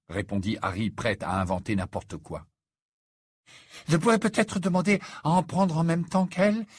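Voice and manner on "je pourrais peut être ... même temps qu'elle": high, hopeful lovesick voice; eager suggestion. A narrator's reading. Ron suggests joining her lessons to Harry, eagerly. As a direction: propose it with greedy eagerness. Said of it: "suggéra Ron, le regard avide".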